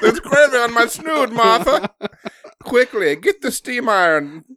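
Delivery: high, loud laughing fuss; hammed-up period voice; mock frantic aristocrat. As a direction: hurry the maid in a panic. Period-drama parody continues.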